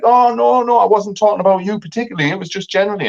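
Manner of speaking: high, breezy brushing-off voice; mock dismissive date. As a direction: imitate her waving it away. Mimicking her dismissive excuse.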